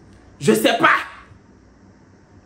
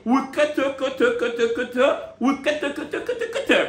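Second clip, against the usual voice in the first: laughing; 3 semitones higher than usual. Real, not acted. Laughing repetition.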